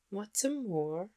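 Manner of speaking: put-on a curious girl asking, rising; little girl voice. Mary asks about the moor.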